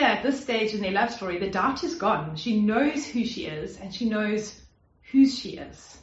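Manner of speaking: ordinary talk, nothing marked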